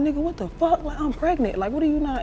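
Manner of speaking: high, disbelieving protest; retold incredulous outburst. Pregnant girl to her boyfriend, incredulous. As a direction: act out being thrown by his answer.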